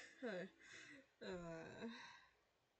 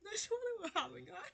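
laughing; 12 semitones higher, 9 dB louder than usual. Real, not acted. Reading game line while laughing.

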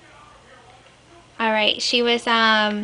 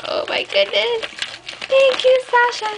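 overjoyed thanks; 12 semitones higher than usual. Real, not acted. Thanking Sasha for the album, high overjoyed gushing. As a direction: gush thanks to a friend.

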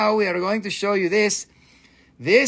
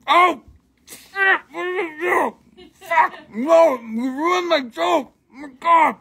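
real shock; 10 semitones higher than usual. Real, not acted. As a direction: cry out as the joke falls apart. Joke ruined, dropped item.

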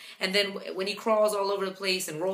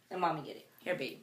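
quiet, lower, gentle; 4 semitones lower, 10 dB quieter, faster than usual. Real, not acted. Speaking softly to the baby.

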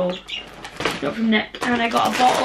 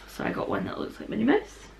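whispering from lost voice; slower than usual. Real, not acted. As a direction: whisper it, you can barely speak. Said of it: "Apologies for the voice".

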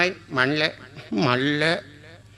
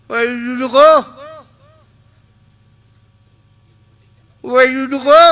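shouted slow broken English, higher and louder; 6 semitones higher, 7 dB louder, much slower than usual. Acted. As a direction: call out across the street in pidgin English. Calling out to a foreigner.

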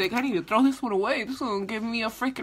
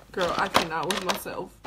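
usual speaking voice

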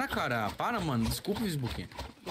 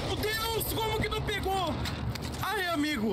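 panicking gamer; 9 semitones higher than usual. Real, not acted. Teammate fails to get kill.

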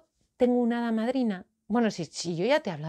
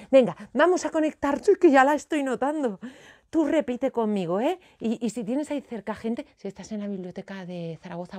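usual speaking voice

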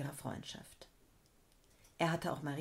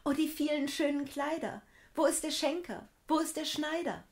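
high, loud, delighted calling out; a girl thrilled by new clothes; clapping and crying out with delight; joyful cry; 9 semitones higher, 5 dB louder than usual. A narrator's reading. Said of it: "klatschte in die Hände und rief".

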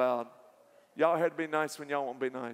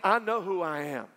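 normal talking voice

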